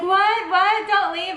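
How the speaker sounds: high, loud panicked begging; pleading panic. Retold or imitated. Mother begging her partner not to leave, high and panicked.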